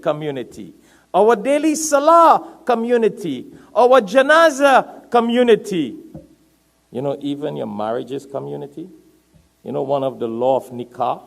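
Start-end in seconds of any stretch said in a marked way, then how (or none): 1.1-6.0 loud, high sermon delivery, words stretched; impassioned preaching. Real, not acted. Preacher urging the congregation not to be aloof, loud and impassioned. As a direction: preach it at full force.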